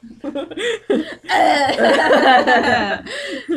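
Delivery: loud burst of laughter. Real, not acted. Long laugh after a rejection.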